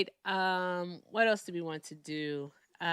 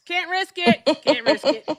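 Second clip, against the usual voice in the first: laughing; 10 semitones higher, 9 dB louder than usual. Real, not acted. Jokes about a ban.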